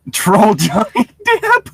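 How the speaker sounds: excited. Acted. Calls out the nickname.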